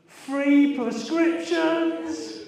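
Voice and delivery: loud, high and slow with glee; excited. Real, not acted. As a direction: savour every word of the perk. Delighted punchline about turning sixty.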